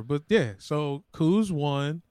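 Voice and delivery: ordinary delivery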